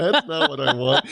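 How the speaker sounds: high-pitched protest through laughter; laughing protest. Retold or imitated. Turning down a company's pitch, laughing. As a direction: laugh while rejecting the offer.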